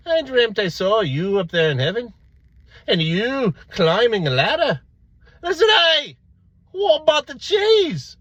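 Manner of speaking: loud, high, mock-grand dream telling; man calling to heaven. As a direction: call up to someone far above. Man tells his dream, calls up.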